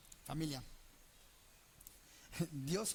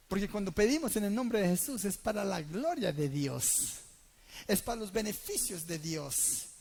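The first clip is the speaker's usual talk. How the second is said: loud, driving sermon line; preaching at full force; 7 dB louder than usual. Acted. Preacher proclaiming loudly to the church, full force. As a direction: preach it out to the congregation.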